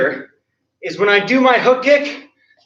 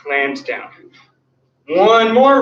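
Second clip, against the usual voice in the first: usual speaking voice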